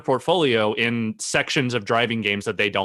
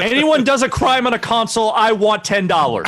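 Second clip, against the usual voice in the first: high, loud comic executive voice; badly faked CEO impression, pitched high; Andrew Wilson; 9 semitones higher, 5 dB louder than usual. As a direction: demand a cut like a greedy boss. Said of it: "That's my Andrew Wilson impression".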